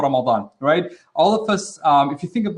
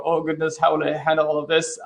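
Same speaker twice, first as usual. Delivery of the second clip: ordinary delivery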